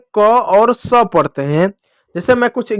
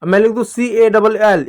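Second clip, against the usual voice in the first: ordinary talk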